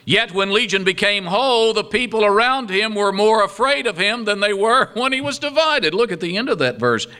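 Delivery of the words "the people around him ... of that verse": ordinary delivery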